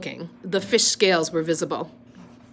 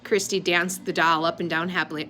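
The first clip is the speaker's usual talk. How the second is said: ordinary delivery, nothing marked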